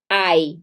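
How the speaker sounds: "ai" as one gliding vowel, like English eye; diphthong demonstration. Shown as a demonstration. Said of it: "você vai falar". ("ai" num só ditongo, demonstrado)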